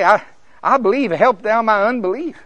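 high, loud, urgent cry for help; impassioned plea. Acted. Father honestly admitting his doubt to Jesus, impassioned plea.

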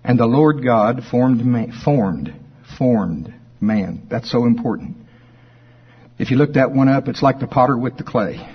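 ordinary talk

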